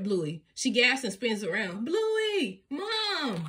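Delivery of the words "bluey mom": calling out. A narrator's reading. Calling across beach.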